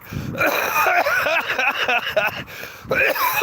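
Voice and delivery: long high-pitched cackling laugh; high, rolling cackle; real laughter. Real, not acted. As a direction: laugh hard at your own misfortune. Laughing at his three-month ban.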